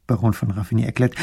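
plain reading voice

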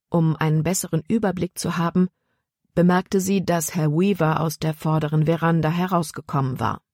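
plain reading voice

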